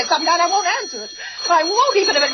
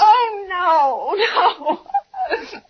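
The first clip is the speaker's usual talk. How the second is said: crying, higher, voice breaking; sobbing no; 4 semitones higher, much slower than usual. Acted. Helpless woman sobbing at the operator. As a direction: collapse into sobs.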